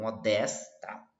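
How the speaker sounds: ordinary talk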